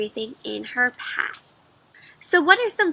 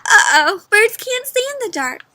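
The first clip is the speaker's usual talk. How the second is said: high, loud alarmed realization; 9 semitones higher, 7 dB louder than usual. Acted. Bat realizes the falling birds can't see. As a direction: sound the alarm.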